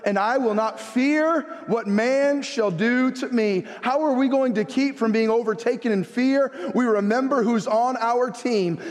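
high, emphatic scripture declaration; bold preaching. Real, not acted. Pastor declaring God as helper against fear, bold preaching. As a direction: declare it boldly, stretching key words.

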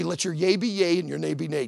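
ordinary delivery

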